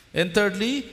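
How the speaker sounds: high, loud preaching push; full-force preaching. Acted. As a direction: drive the promise home to the congregation.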